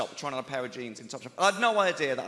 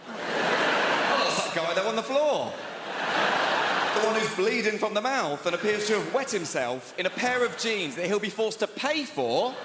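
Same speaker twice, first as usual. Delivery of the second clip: slow, higher mock-helpful answer; sarcastic reply; 6 semitones higher, 4 dB louder, slower than usual. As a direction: spell it out slowly and snidely.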